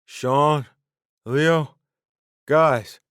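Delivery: loud high pleading calls. A narrator's reading. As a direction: call out desperately for friends. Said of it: "he pleaded".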